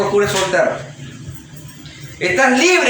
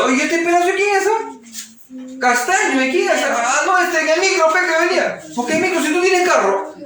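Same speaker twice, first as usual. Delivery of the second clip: much higher interrogating voice; suspicious wife; 8 semitones higher than usual. Acted. Wife questioning husband about a strange hair, suspicious and high. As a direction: catch him out with the hair.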